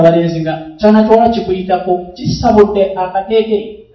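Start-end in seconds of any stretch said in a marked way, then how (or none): none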